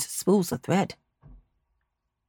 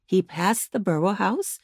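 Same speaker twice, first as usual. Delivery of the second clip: ordinary delivery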